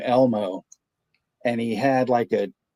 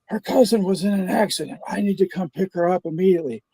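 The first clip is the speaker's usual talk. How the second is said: higher, pleading husband voice; prank caller; 8 semitones higher, faster than usual. Retold or imitated. Prank caller asking the pizza clerk for his wife, pitched-up worried husband. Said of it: "and he's like".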